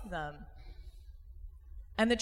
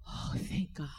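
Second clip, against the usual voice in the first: softly whispered, lower sigh of relief; relieved whisper; 5 semitones lower, 5 dB quieter, slower than usual. Retold or imitated. Speaker shows the audience's reaction, in a low relieved whisper. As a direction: breathe it out like a secret relief. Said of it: "And that should make you go".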